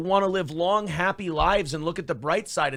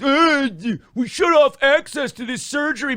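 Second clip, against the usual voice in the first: higher, louder whiny mock voice; mock him, pitched up and loud; mocking impression; 7 semitones higher, 4 dB louder than usual. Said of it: "he goes".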